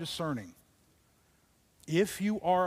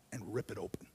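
whisper; 9 semitones lower, 12 dB quieter, faster than usual. Acted. Preacher dramatises the story.

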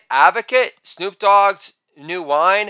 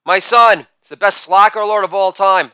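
ordinary talk, nothing marked

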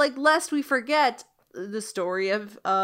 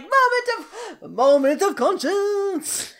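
loud, higher mock French accent; Lumiere's French accent; 9 semitones higher, 5 dB louder than usual. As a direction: ham up a bad French accent. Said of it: "we get Lumiere being like".

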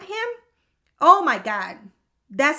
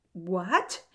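lower, softer, drawn-up questioning "what?"; stunned; 5 semitones lower, 5 dB quieter than usual. Retold or imitated. Couldn't say anything after transfer news, stunned. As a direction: speechless disbelief, rising at the end. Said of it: "my face was like".

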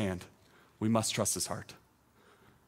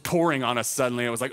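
ordinary talk, nothing marked

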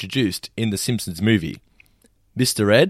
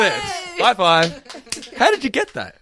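excited; 12 semitones higher, slower than usual. Real, not acted. Cheering wife's correct answer.

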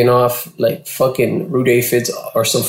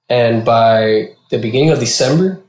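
ordinary talk, nothing marked

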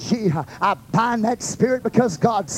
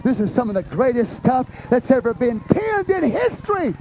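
full preaching; 12 semitones higher than usual. Acted. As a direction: build the sermon to a peak.

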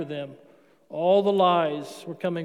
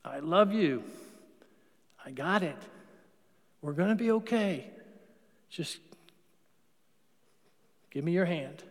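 tender reassurance; 2 semitones lower, 5 dB quieter than usual. Acted.

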